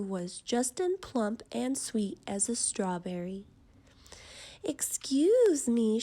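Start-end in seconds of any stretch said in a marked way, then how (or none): 4.6-6.0 higher, slow and cheery; bright old woman. A narrator's reading. Old woman greets the worried father, brightly and slowly. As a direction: greet them sweetly like a kindly grandma. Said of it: "she said brightly".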